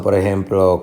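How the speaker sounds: normal talking voice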